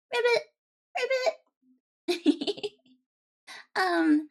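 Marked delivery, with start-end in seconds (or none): 0.1-2.7 playful high ribbit croak; frog croak. Pretend. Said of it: "There's a frog in my throat".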